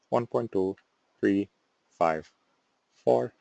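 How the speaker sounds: quiet droning glitchy robot. Retold or imitated. Presenter re-does the Arduino as a soft robot. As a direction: count off numbers like a malfunctioning robot. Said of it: "robot that wasn't quite right in the head".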